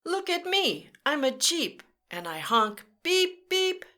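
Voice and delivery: put-on bright, high read-aloud voice for kids; story reading. A narrator's reading. Storyteller reading to children, lively. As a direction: read it like a jeep showing off.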